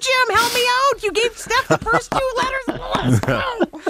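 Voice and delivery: high, pleading near-shout; desperate plea. Real, not acted. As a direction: beg for help, voice climbing.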